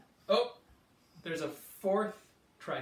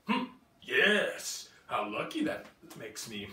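roaring T-Rex; 4 semitones lower than usual. Acted. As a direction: boom it like a big dinosaur. T-Rex voiced in story.

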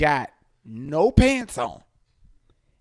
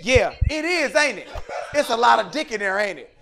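laughing tease; 5 semitones higher, 4 dB louder than usual. Retold or imitated. Teasing reply while laughing.

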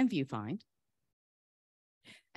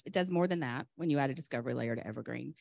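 ordinary talk, nothing marked

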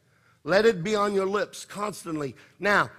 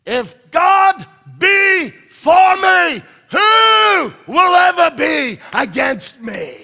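slow, high, huge roar, higher and louder; roared scripture; 9 semitones higher, 11 dB louder, slower than usual. Shown as a demonstration. Pastor demonstrating how to roar God's word, slow and loud. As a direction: bellow the verse like a lion. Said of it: "this is how you roar the word of God".

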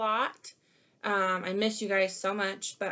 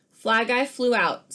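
lively picture-book read-aloud; animated storybook reading; reading to children; 4 semitones higher, 6 dB louder than usual. Acted. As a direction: read it like story time for kids.

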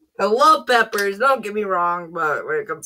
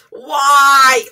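pained laughing shout; 8 semitones higher, 8 dB louder than usual. Real, not acted. Burning mouth, laughing cry.

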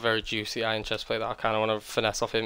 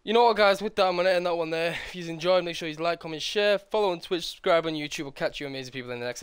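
YouTube outro; 6 semitones higher than usual. Acted. Channel outro.

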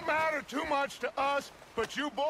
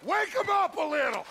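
loud, higher barked command; shouted order; 7 semitones higher, 3 dB louder than usual. Acted. Outlaw boss shouting orders to his men, loud. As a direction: shout it over the noise. Ordering gunfire at the train car.

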